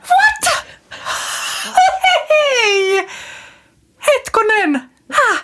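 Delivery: shocked disbelief. Real, not acted. Hearing Stubb isn't Finland-Swedish, squealing surprise. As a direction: react as if the news floors you. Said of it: "Miten niin?".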